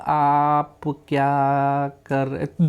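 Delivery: drawn-out, dragging words; overly slow speech. Shown as a demonstration. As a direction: stretch every word far too slowly. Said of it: "अब मैं बोलने लगूँ". (बहुत धीमे खिंचे हुए शब्द)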